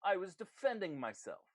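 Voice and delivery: high-pitched aggrieved self-justification; defensive protest. Acted. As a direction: insist it was self-defense. Accused character justifies himself.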